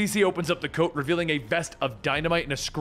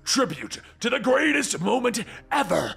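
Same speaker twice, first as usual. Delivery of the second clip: high, building villain rapture; exultant Joker; 8 semitones higher, slower than usual. A narrator's reading. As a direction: savor it like a grand showman.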